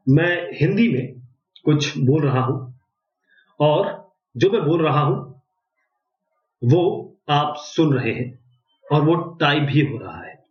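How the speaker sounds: धीरे, हर टुकड़ा अलग और साफ़; वेबसाइट को सुनाने के लिए ठहर-ठहर कर; slow Hindi dictation. Shown as a demonstration. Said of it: "तो मैं आपको शुरुआत करता हूँ।". (धीमी, साफ़ हिंदी में डिक्टेशन)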